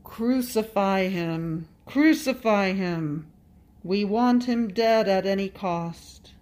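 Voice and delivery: loud, slow, drawn-out shout; crowd's cry. A narrator's reading. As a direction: cry it out like a mob demanding death. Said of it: "I hear the cry of all".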